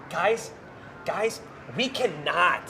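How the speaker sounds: mock panicked impersonation, halting and drawn out; Brian. Colleague joins in teasing a freaked-out coworker, mock alarm. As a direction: imitate an alarmed coworker pleading slowly. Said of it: "that's just my Brian impersonation".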